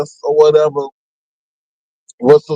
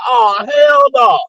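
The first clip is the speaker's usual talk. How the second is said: outraged shout; 4 semitones higher, much slower than usual. Retold or imitated. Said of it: "I was like".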